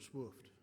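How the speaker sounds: Swift said like swuft, the vowel rounded to uh; Mississippi Swift. Shown as a demonstration. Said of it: "that's a Mississippi word".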